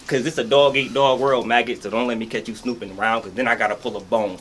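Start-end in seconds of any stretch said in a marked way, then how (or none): none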